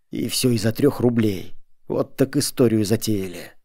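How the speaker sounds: ordinary delivery, nothing marked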